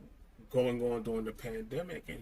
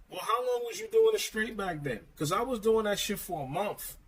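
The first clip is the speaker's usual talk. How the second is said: high-pitched, louder incredulous challenge; pitched-up, mildly indignant comeback; exasperated retort; 9 semitones higher, 4 dB louder than usual. Acted. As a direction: fire back at them in disbelief.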